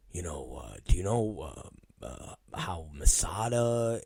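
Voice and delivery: nice caring voice, very soft and low; hesitant, gentle imitation of a concerned friend; Michael Moran. Producer asking after a colleague, soft caring voice. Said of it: "caring voice".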